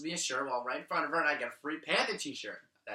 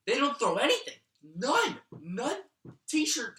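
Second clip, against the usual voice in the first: surprised; 8 semitones higher than usual. Real, not acted. Indignant no t-shirts.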